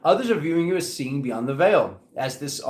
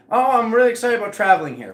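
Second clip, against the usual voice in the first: excited quote; 7 semitones higher, 5 dB louder, faster than usual. Retold or imitated. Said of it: "For somebody, it could be".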